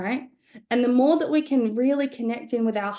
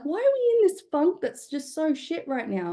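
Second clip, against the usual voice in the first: exasperated; 7 semitones higher than usual. Retold or imitated. Retold despair at breakfast.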